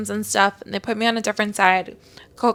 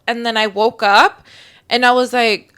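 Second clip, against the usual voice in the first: usual speaking voice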